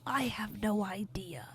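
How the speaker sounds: hushed whisper. Acted. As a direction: breathe it with no voice. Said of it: "you hear Sahiba say back".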